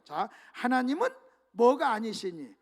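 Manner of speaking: usual speaking voice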